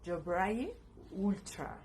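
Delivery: Dobroje Ultra slowly, word by word; pronunciation of Dobroje Ultra. Shown as a demonstration. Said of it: "y se pronuncia". (pronunciación lenta de Dobroje Ultra)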